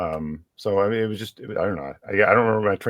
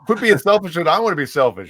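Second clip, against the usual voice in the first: higher, louder hammed-up family voice; mock family member; 8 semitones higher, 5 dB louder than usual. Family member scolding the girl, hammed-up and higher. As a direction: play the selfish family member, tongue in cheek. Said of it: "And it's like".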